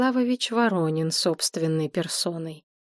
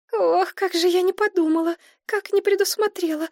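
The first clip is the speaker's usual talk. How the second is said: much higher, fussing like a hen; clucking fuss; 11 semitones higher than usual. A narrator's reading. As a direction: cluck over him, flustered. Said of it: "заквахтала Мария". (квохчет, суетливо и намного выше)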